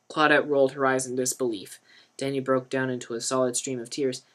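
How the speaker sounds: ordinary delivery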